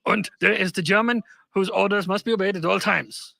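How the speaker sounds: lightly puppet-like stern German; a hint of a Muppet giving orders. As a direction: play a commanding German as a silly puppet. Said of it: "you turned into a Muppet with that".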